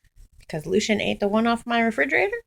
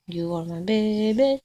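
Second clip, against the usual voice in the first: normal talking voice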